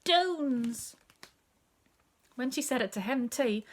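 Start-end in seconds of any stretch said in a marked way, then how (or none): 0.0-1.0 put-on high toddler blurt, D for J; high, falling baby-talk shout. A narrator's reading. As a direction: announce it high like a proud baby. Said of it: "to hear my sister say".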